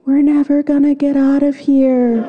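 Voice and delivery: high, sustained mock scream; imitating a friend's panicked scream; screamed despair. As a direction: wail it skyward like a stranded friend. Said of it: "and screamed".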